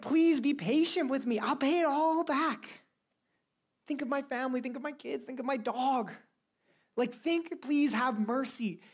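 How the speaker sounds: high, desperate pleading voice; begging servant. Acted. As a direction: plead like a debtor on his knees. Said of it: "he begged his master".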